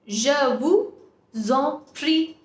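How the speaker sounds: very slow, word by word, pauses inside; slow model pronunciation. Shown as a demonstration. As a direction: draw out "Je vous en prie" for learners. Teacher models phrase slowly for learners.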